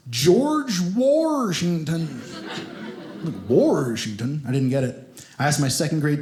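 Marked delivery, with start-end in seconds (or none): 0.1-2.2 "Washington" drawn out with an R, as "Warshington"; Kentucky pronunciation. Shown as a demonstration. Comic shows how Kentucky says the president's name, "Washington" as a long "Warshington". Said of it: "in Kentucky, they pronounce". 3.5-4.4 "Washington" as "Warshington", rising like a question; Kentucky pronunciation. Shown as a demonstration. Puzzled second grader repeats the name, "Washington" as a questioning "Warshington". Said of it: "in Kentucky, they pronounce".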